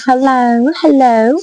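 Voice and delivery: mock British landlady picking up the phone; bad British accent. Pub lady answering a payphone call, bad British accent. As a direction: put on a poor British accent. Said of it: "sorry for my bad, um, bad accent there".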